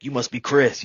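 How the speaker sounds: loud, high, booming hello; hearty greeting. Retold or imitated. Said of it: "And he's like".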